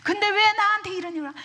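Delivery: aggrieved, high-pitched loud outcry; Job. Acted. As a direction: cry out at the injustice, quick and high. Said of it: "너무너무 억울해요". (억울해서 높고 빠르게 외치듯)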